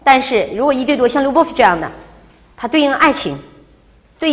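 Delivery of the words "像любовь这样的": ordinary talk, nothing marked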